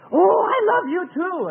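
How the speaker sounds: high, loud, overjoyed outburst; swooping, delighted answer of love; gushing reply. Acted. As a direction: gush it back, high and loud. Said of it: "God does the same thing".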